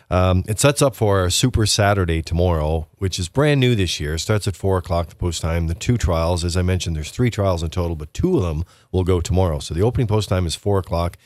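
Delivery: usual speaking voice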